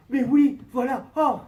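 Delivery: loud, high shocked cry; shocked exclamation. Retold or imitated. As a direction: blurt it out in shock.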